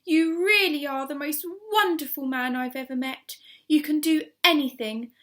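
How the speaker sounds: loud, delighted praise; cried out. A narrator's reading. Missus Silver at the door praising Mister Hoppy, loud. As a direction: gush over him with joy. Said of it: "cried out".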